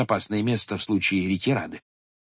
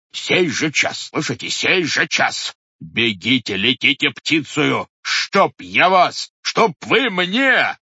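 loud, slow, rising fury; sputtering threats; 6 semitones higher, 6 dB louder, slower than usual. A narrator's reading. Head threatening the watchmen with the commissar, loud and slow. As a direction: hammer out each threat.

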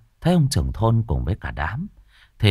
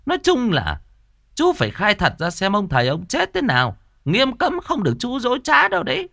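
much higher, irritated village chief; grumpy snapping; 12 semitones higher than usual. Acted. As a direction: scold the man you don't believe. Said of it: "gắt gỏng".

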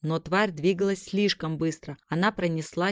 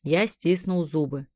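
plain reading voice

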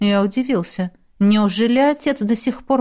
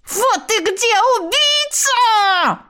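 shrieking monster head; 13 semitones higher, much slower than usual. Acted. Severed head shrieks at hero.

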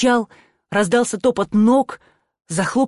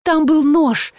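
high, loud panicked outburst; scared, raised-voice babbling; frightened babble; 8 semitones higher, 5 dB louder, slower than usual. A narrator's reading. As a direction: blurt it out in fright, voice pitched up. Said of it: "лепетала Нель".

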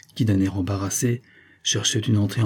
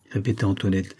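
ordinary delivery, nothing marked

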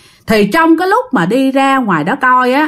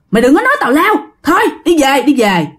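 higher-pitched retold scolding, hustling her home; scolding neighbors; 4 semitones higher, faster than usual. Neighbors order Aunt Tám to stop talking nonsense, higher scolding. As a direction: tell her off and pull her home. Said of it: "mấy người trong xóm mới la".

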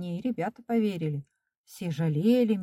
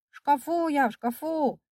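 high, insistent call; 8 semitones higher than usual. A narrator's reading. Boy telling the cleaner where he is, high calling. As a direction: plead to be found, pitched high.

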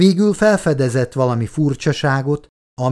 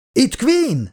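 high-pitched yell into a microphone; acted shout; 12 semitones higher than usual. A narrator's reading. Quinn answering the radio in a storm, high acted shout. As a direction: call out high over a bad line. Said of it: "üvöltötte be a mikrofonba".

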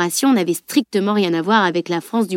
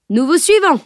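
a much higher, louder shout; 8 semitones higher, 5 dB louder than usual. A narrator's reading. Knight still shouting to the tutor, loud. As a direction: call it out loud and high.

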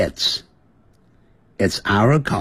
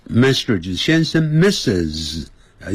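usual speaking voice

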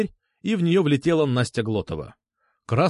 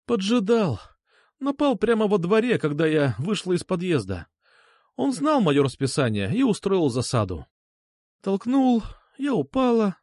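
higher-voiced woman recounting it without emotion; understated, numb detachment; detached, emotionless; 6 semitones higher than usual. A narrator's reading. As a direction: report it as if from a distance. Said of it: "говорила отстранённо, без эмоций".

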